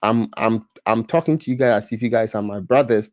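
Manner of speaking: ordinary talk, nothing marked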